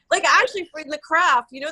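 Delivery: ordinary talk, nothing marked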